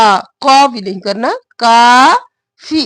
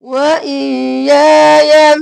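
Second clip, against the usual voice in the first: ordinary talk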